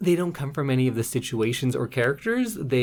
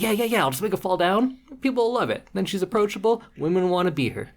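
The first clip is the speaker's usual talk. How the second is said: high, fast, careless writer voice; coked-up writer; 7 semitones higher, faster than usual. Retold or imitated. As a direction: rattle it off high like a wired hack. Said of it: "is like".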